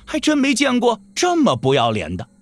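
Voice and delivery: usual speaking voice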